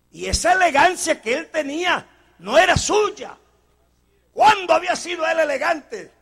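rising sermon delivery; preaching. Acted. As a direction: build the point to the congregation. Preacher building up.

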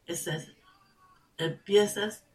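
usual speaking voice

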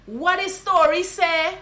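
slow, loud Guyanese Creole question; drawn-out Guyanese hail; Guyanese greeting. Shown as a demonstration. Guyanese teacher showing how to hail a friend. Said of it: "you just say".

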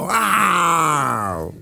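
long drawn-out roaring cry; mock lion roar. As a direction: roar like a lion, slow and low. Lion roars in the forest.

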